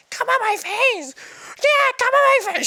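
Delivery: very high, silly mock cry; quoting himself. Host imitating himself in a funny video, high silly voice. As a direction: squeal it out as a joke. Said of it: "that funny video where I said".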